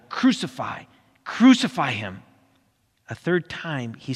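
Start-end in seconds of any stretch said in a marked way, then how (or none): 0.1-2.2 raised, insistent narrated cry; crowd shouting. A narrator's reading. Crowd demanding death from Pilate, shouting. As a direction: call it out like a demanding crowd, short of screaming. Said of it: "but they kept shouting".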